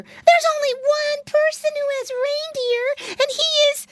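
very high, loud excited piglet; exclaiming piggy boy. A narrator's reading. Piglet realizing who has reindeer, excited exclamation. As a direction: squeal it out, building to the reveal. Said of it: "exclaimed Flop Ear".

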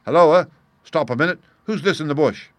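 loud startled call; surprised call. A narrator's reading. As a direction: call out at the sudden find. Holmes calling out on discovery.

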